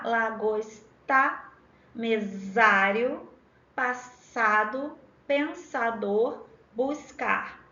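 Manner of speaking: literacy-teacher diction, each word stretched slowly; slow sounding-out. Shown as a demonstration. Teacher reading the options for students, slowly sounding out every phoneme. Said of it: "a gente fala dessa forma".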